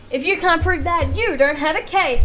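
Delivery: heated challenge. Acted. Witness challenges the lawyer.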